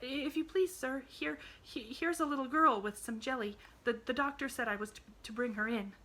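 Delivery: high, halting, stammering servant; frightened maid. A narrator's reading. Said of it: "saying in a frightened voice".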